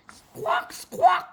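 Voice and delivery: very high double squawk; bird squawk. A narrator's reading. Bird answers the princess, very high squawks. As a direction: chirp it like a seagull. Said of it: "said the bird".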